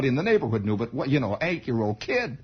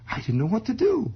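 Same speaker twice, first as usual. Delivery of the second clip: normal talking voice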